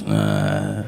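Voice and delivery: very low, soft grunt; reply with nothing but a deep "uh"; wordless phone noise. Retold or imitated. Baffling man replies to a hello, low wordless grunt. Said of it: "answers the phone with a noise".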